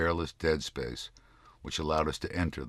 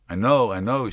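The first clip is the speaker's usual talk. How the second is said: comforting; 7 semitones higher, 10 dB louder than usual. A narrator's reading. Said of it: "she said, as if she knew".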